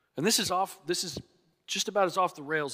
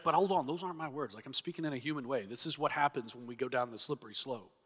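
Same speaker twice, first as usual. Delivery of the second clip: a quick, softer disclaimer; Paul's hushed aside; 2 semitones lower, 7 dB quieter, faster than usual. Retold or imitated. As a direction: brush it off quickly under your breath. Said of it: "but he's like".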